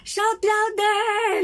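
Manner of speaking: high, slow, teasing jeer; mocking taunt. Elijah taunting the prophets of Baal, slow high mockery. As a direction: drag it out to mock them. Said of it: "nang aasar".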